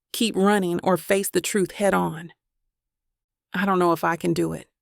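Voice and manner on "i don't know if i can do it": plain reading voice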